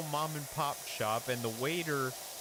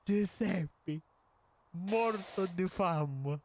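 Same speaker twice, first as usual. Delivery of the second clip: higher, slow, theatrical Italian phrase; milk the phrase for drama; hammed-up tourist Italian; 7 semitones higher, much slower than usual. Tourist telling Giuseppe he is dying of hunger, higher and slow. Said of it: "I said".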